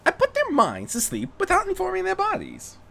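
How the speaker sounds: lightly voiced Rarity. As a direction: play her pleased with herself, lightly.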